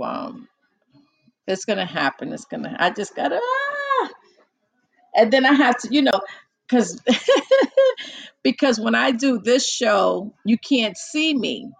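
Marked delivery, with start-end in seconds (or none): 3.4-4.1 frustrated cry. Real, not acted. Frustrated outburst. 7.1-8.4 high loud burst of laughter; real laughter. Real, not acted. Laughing at herself.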